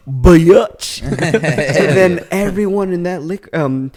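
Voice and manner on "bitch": drawn-out, loud mock insult; loud punchline insult. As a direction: belt it out as the comic payoff. Punchline retort to offended woman.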